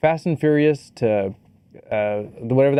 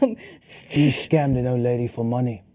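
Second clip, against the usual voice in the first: put-on British accent; 2 semitones lower than usual. Action hero confronting a scammer, British accent. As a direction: play a British action hero making an accusation. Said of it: "Good job on your British accent".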